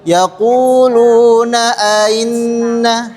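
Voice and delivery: slow "Yaquluna ainna" half-clear; one hamzah unclear. Shown as a demonstration. Teacher shows a half-clear hamzah wrongly. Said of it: "yang satunya tidak".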